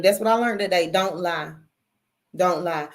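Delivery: ordinary talk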